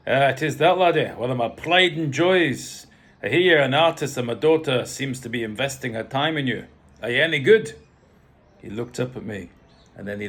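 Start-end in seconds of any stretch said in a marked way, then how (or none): 0.1-7.7 loud, commanding Scottish father; rough Scottish accent. A narrator's reading. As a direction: size up the newcomer as a blunt Scot. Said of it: "Scottish, slightly rough".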